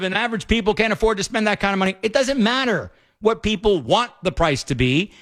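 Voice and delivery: usual speaking voice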